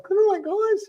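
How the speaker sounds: very high squeaky imitation; mimicking pet voice. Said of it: "But you were like".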